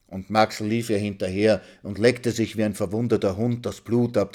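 plain reading voice

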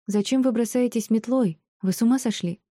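high-pitched outraged protest; understated shout of indignation; indignant cry. A narrator's reading. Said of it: "закричала она старушке".

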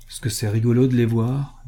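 ordinary delivery

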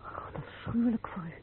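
hushed sympathy; soft horrified whisper; whispered pity. Acted. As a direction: whisper it, pained. Pity for disfigured man.

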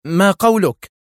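high, loud, edgy demand; nervous cry. A narrator's reading. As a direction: demand an answer on frayed nerves. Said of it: "هتفت سونيا في عصبية". (هتاف عصبي)

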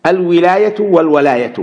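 الولاية والولاية as "al-wilāyatu wal-walāyatu"; first الولاية with an "i" after waw, second with an "a"; kasra, then fatha. Shown as a demonstration. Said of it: "وبفتحها".

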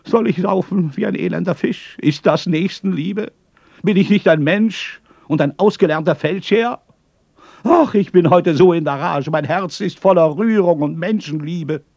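high, loud, miserable falsetto lament; pitiful falsetto wail. Acted. Drunk breaks into weeping before the inn, falsetto wail. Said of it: "fuhr ganz erbärmlich durch die Fistel fort".

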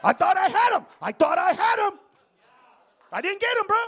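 high, full-voiced shout; exclaiming loudly. Real, not acted. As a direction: shout it out in disbelief to the room. Comic exclaiming to the audience.

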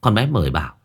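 ordinary delivery

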